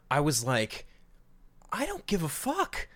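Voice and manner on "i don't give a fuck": high-pitched mock exasperation; exasperated indifference. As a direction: squeak it out in exasperated disbelief. Quoting own exasperated reaction to Hercules scene.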